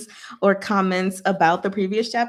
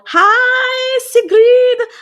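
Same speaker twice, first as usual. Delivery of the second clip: loud, high, drawn-out hello; delighted shout of welcome; excited greeting; 11 dB louder, much slower than usual. Real, not acted. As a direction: sing out a big hello, far above your usual pitch. Greeting a listener who joins.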